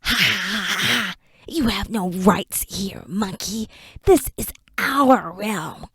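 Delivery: slow, hushed sneer with a dark laugh; mocking creature. Creature gloating over Enoch, slow and mocking. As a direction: gloat over the helpless prisoner.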